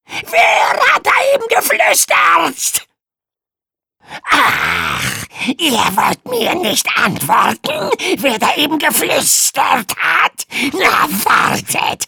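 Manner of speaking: high, loud furious scolding; angry dragon shriek. Acted. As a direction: scream at the class. Dragon teacher berates class.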